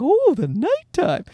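lightly voiced delighted little boy; imitating toddler. Dad imitating his son discovering nighttime, mock toddler wonder. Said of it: "He was like".

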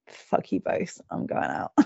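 very soft, voiceless mutter; retold fed-up muttering. Mum retelling her exit from laughing family, fed up. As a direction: curse them under your breath.

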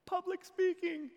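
comic high-pitched reveal; squeaky punchline. Real, not acted. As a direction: land the joke high. Punchline, self-mocking.

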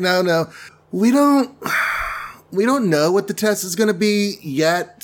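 high-pitched backpedaling with a sigh; awkward mock protest. The Avengers admit having no test, high awkward protest. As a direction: pitch it up, hastily correcting him.

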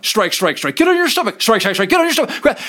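a second cop shouting the same orders, retold; second officer shouting. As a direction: bark the orders over each other. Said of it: "And then the next one".

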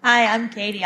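ordinary delivery

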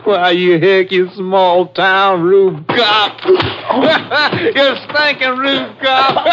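loud, high, laughing contempt; slow mocking cackle in the voice; jeering taunt. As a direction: jeer at the lawman, drawing it out. Gunman jeers at the constable.

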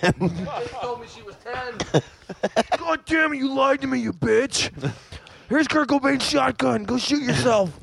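mock outrage. Friend playing a caught predator, hammed-up outrage. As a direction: ham up the outrage.

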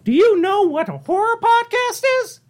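very high squeaky Mickey voice; chirpy cartoon mouse, way up high. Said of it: "it's more like this".

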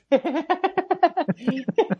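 high, helpless giggling; high-pitched laugh. Real, not acted. Listener reacting to the joke's punchline, high-pitched laugh.

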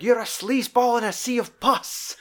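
high, mock sneering insult; hammed-up insult. Jack insulting a rival, hammed-up sneer. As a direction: fling the insult in a put-on voice. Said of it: "He's got this great line".

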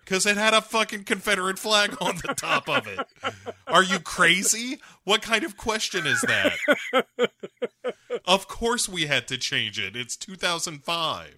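high, loud incredulous rant; mock-outraged comic tirade; exasperated disbelief. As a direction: snap back at a stupid question. Acted exasperated answer to press question.

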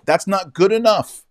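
indignant outburst; emphatic. Real, not acted. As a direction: fire it back with indignation. Emphatic retort.

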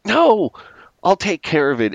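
high-pitched acted refusal; mock protest. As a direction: imitate someone frantically refusing help. Acted snappy refusal of help.

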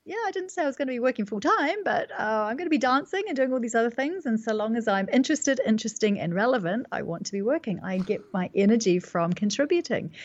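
bright, quick answer to doubters; quoting herself. Retold or imitated. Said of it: "And I say".

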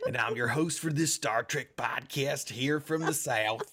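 hammed-up Southern drawl, drawn-out words; mock Southern accent. Podcast host greeting listeners as a pretend Southerner, mock Southern accent. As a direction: put on a broad, slow Southern accent. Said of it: "This is how I really talk".

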